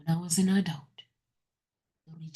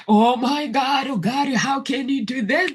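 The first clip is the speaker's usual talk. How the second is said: hammed-up whiny wail, louder and higher; mock crying complaint; 7 semitones higher, 6 dB louder than usual. Woman mocking her own crying complaint to God. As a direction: imitate a self-pitying cry to God. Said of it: "you cannot cry and say".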